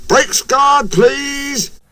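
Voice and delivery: high calling voice; urgent call. A narrator's reading. Engine asks guard for brakes, high call.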